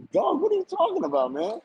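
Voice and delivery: high-pitched incredulous retort; mock-exasperated comeback, high; retold exasperation. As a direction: act out baffled protest, voice way up. Retold exasperated reply to heckler.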